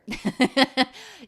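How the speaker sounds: loud laugh breaking out; cracking up; burst of laughter. Real, not acted. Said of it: "laughs at own story".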